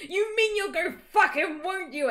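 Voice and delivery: high, loud mock fury; angry shout. A narrator's reading. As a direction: shriek the accusation, hammed up. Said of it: "I shouted angrily".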